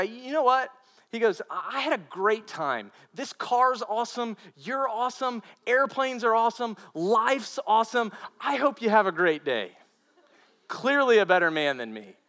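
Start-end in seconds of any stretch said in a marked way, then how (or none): 0.0-9.7 cheerful praise. Retold or imitated. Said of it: "he says to the guy".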